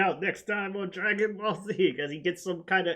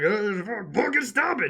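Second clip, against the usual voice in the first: yelling; 6 semitones higher than usual. Retold or imitated. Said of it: "yelling at Whis to stop it".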